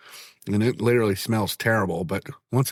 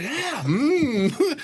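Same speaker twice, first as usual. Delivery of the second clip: high mock chewing; 14 semitones higher than usual. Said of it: "You're like".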